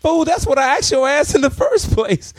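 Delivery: high, exasperated put-down; exasperated retort. Retold or imitated. Owner scoffing at the drunk thief who offers money. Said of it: "I'm like".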